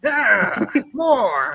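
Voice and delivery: dissatisfied director pushing the actor, hammed up; demanding director. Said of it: "Could you imagine the directors doing this".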